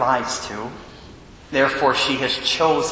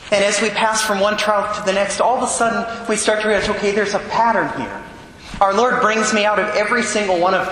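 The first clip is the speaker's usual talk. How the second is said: raised, urgent preaching voice; fervent preaching; 5 semitones higher than usual. Acted. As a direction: preach it with rising fervor.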